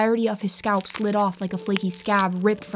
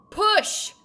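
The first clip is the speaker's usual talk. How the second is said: much higher, sharp yell; 13 semitones higher than usual. A narrator's reading. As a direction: shout the order. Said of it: "she yelled".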